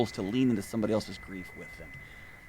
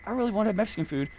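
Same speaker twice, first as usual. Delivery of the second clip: whiny inner voice; 9 semitones higher, much faster than usual. Retold or imitated. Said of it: "this is saying".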